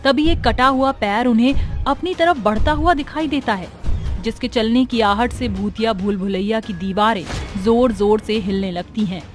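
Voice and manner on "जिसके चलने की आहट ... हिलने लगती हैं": ordinary delivery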